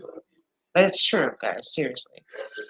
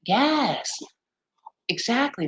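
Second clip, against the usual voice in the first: usual speaking voice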